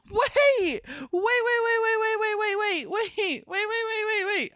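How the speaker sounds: very high, rapid-fire squeal; frantic excitement. Real, not acted. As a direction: repeat it frantically, high-pitched. Frantic excitement at puppet idea.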